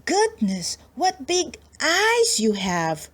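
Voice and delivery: put-on girl stares at the wolf, high and slow; wondering little girl. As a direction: marvel like a small child. Girl in the bed scene.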